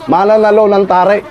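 high, hyped-up explaining; excited commentary. Real, not acted. Fight watcher likening Russell to a rooster, excited.

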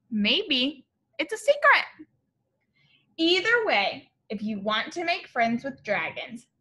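lively, sing-song storybook read-aloud; bright read-aloud for little kids; reading to children. A narrator's reading. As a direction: read it like a picture book to a class.

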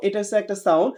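ordinary talk

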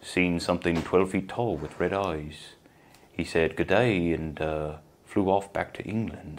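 soft, slow Kiwi that comes out British; badly faked New Zealand newsreader; attempted Kiwi accent. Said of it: "That's, that's a British voice".

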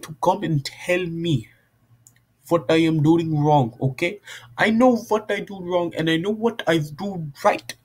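put-on high, sing-song amateur Indian accent; exaggerated Indian accent with swooping pitch. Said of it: "and an Indian accent".

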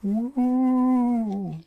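long, high, loud "wooo"; sustained high ghostly wail; spooky cave wail. A narrator's reading. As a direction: howl like a haunted cave, with relish. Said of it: "read Brother with a lot of expression".

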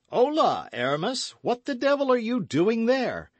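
cheerful call across a distance; hailing cry. A narrator's reading. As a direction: shout up to a friend at his window. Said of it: "cried the two friends".

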